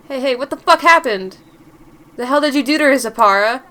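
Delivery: loud, heated demand for answers; angry confrontation. Acted. Friend confronting a prankster over Zapara, loud and angry. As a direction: burst in, loud and outraged. Acted quarrel after a prank.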